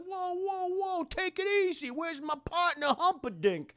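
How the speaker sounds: high put-on cop voice; Detective Bobbity Boop. Detective at gunpoint seeks partner, high put-on voice.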